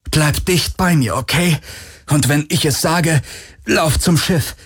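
tense, urgent instruction; urgent man's voice. Acted. Urgent warning in danger.

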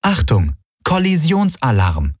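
high-pitched warning from the ship; ship's alarm announcement. A narrator's reading. The Vago warning its crew, alarm announcement. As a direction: announce a collision alert, pitch jumping up. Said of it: "meldete die Vago".

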